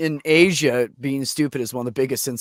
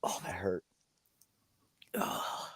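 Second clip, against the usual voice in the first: breathy hushed recoil from a bad sound; soft whispered shudder of disgust; cringing whisper; 7 semitones lower, 15 dB quieter, slower than usual. Real, not acted. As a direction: whisper it through a real cringe.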